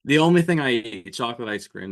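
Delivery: usual speaking voice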